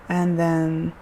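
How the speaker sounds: usual speaking voice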